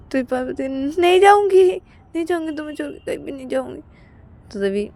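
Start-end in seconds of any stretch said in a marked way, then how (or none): 1.0-3.8 soothing promise. Acted. Payal promising Ananya she will not leave. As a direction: reassure him quickly.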